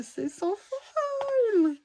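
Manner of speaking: high, slow, squealing with delight. Real, not acted. Unboxing paper, thrilled at no foil, high delighted squeal.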